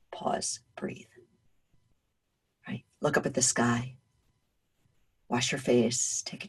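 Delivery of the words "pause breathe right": hushed, breath-only self-reminder; calming whisper. Acted. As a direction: whisper it to settle yourself. Calming herself out of overthinking.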